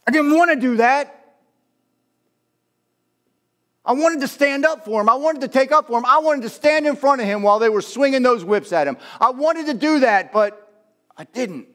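high, loud, impassioned regret; anguished self-reproach. Acted. Peter berating himself after the denial, anguished loud outburst. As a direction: cry out the regret at full preaching force. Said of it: "I can imagine he's sitting there saying".

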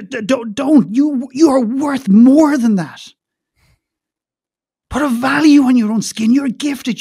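high, forceful, stammering plea; impassioned urging. Retold or imitated. Father urging Sarah to leave her job, impassioned.

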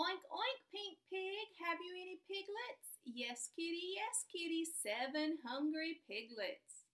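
slow, sing-song storybook read; reading to children. Acted. Teacher reads a rhyming story to her class, sing-song. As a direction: read it out slowly, like a picture book at snack time.

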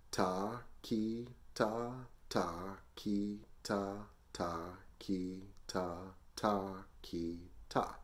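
slow, evenly spaced syllables in threes; slow group counting. Shown as a demonstration. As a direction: count slowly so learners can join in. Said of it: "Let's do it slow together".

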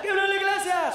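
quick replay of the hype intro; announcer call. Retold or imitated. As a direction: belt the name like the MC did. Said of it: "It threw me off so bad".

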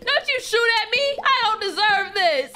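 terrified shouting at the shooter; panicked yelling. Acted. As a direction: scream while being shot at. Being shot at, screaming.

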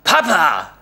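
loud, high stunned cry of Papa; dismayed call. Acted. Son calling his father in dismay, loud high cry. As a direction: call out in disbelief. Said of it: "rief ich fassungslos".

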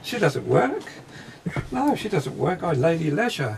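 high, loud voice of a chirpy lady of leisure; chirpy spirit lady. Retold or imitated. Psychic voicing a spirit lady who doesn't work, chirpy and insistent. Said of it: "Very chip-, chirpy".

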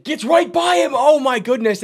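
high, amazed burst; thrilled play call. Real, not acted. Commentator as LaVine gets right by Middleton, thrilled play call. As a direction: cry out high as he scores.

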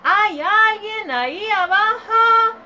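put-on child yelling harder down the hole. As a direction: yell it down the hole with all your might. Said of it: "Gritó con más fuerza".

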